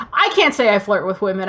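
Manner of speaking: ordinary talk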